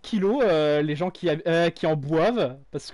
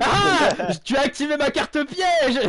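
triumphant shout; 6 semitones higher, slower than usual. Pretend. Triumphant game-card taunt, laughing.

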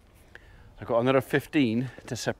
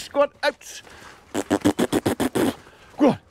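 herding call, pitched high and pushed; calling to cattle; 11 semitones higher than usual. Real, not acted. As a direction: drive them out with a high yell. Driving cattle out of pen.

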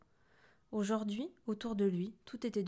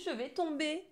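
high-pitched panicked cry; 7 semitones higher than usual. A narrator's reading. Lou afraid of falling, panicking to Alfred. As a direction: plead as if about to drop. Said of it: "paniqua-t-il".

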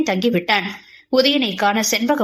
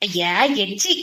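grossed-out giggle. A narrator's reading. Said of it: "உள்ள சிரிப்புடன் தன் முகத்தை அஷ்ட கோணலாக்கி".

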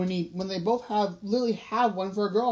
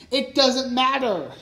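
louder, higher, drawn-out protest; heated, raised-voice outburst; crying out; 5 semitones higher, 6 dB louder, slower than usual. A narrator's reading. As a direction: cry it out in frustration. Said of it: "cried Paps".

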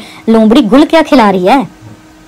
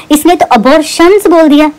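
Abortions raised higher, its plural ending marked; pointed stress on abortions; 4 semitones higher than usual. Real, not acted. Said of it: "plural आ गया ना".

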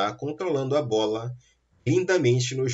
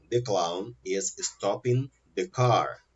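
slow, softer repeat of the sentence; 3 semitones lower, 4 dB quieter, slower than usual. Shown as a demonstration. Teacher repeating the example slowly for students. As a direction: say it again slowly for learners. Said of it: "example repeated, slow and softer".

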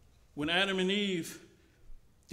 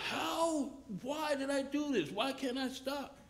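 high anguished questioning; despairing cry; 7 semitones higher, 3 dB quieter than usual. Pretend. Preacher voicing a relapsed believer asking why he sinned again, high anguish. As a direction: plead in despair at yourself. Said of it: "And you say".

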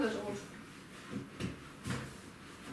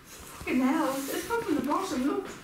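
swear, then complain in a higher pitch; real agitation, higher and stumbling over repeats; exasperated; 5 semitones higher than usual. Real, not acted. Showing a companion the spray leaks from the bottom, exasperated.

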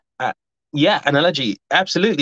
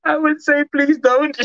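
laughing; 13 semitones higher than usual. Real, not acted. Laughing warning to commenter.

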